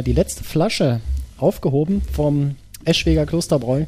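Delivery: ordinary delivery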